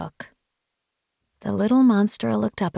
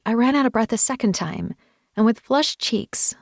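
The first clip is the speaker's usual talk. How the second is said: ordinary delivery, nothing marked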